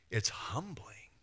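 slow, hushed whisper; quietly overwhelmed whisper; awed whisper. Real, not acted. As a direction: let the awe hush you. Awed after answered prayer.

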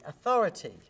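AUTHORITY loud, pitch dropping at the end; authoritative drop. Shown as a demonstration. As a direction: punch the middle of AUTHORITY, then fall. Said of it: "went down then".

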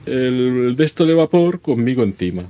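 ordinary delivery

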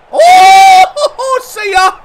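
laughing and shouting with delight. Real, not acted. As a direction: yell it out mid-laugh. Laughing at a huge hit.

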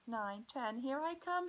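counting up to a high, loud call; building call. Acted. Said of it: "she cried".

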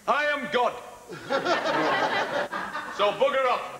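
loud, high northern bellow; booming mock proclamation of divinity; Brian Glover. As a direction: bellow it like a blunt Yorkshireman. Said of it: "Brian Glover".

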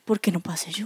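low, soft murmured question; question never asked. Retold or imitated. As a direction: murmur it like a question kept to yourself. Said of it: "cometí el error de no haberle dicho".

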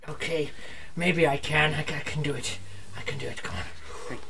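low, hushed pep talk to yourself; psyching himself up under his breath; nervous self-encouragement. Acted. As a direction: mutter reassurance to yourself, quiet and low.

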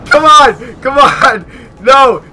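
high, loud desperate yell; real rage-shout at the screen; frustrated shout. Real, not acted. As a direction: scream it at the game as you lose. Being shot at in game.

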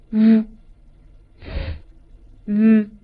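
f and v as isolated, sustained friction sounds; fricative demonstration. Shown as a demonstration. Teacher shows learners example fricatives, f and v sounded alone. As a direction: hold f as airflow through a narrow gap, pause, then a buzzing v. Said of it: "我们就叫它摩擦音".

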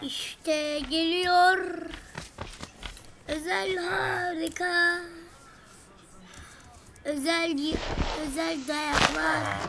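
drawn-out slow mock trailer voice; slow dramatic boast; slow hype. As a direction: stretch every word like a hype announcer. Hyping himself up.

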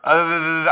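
long trilled R; rolled R attempt. Shown as a demonstration. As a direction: try to roll a sustained R. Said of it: "How do you roll the R's?".

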